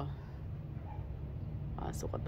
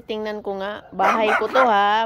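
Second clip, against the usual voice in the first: ordinary talk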